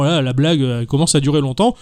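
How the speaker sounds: ordinary talk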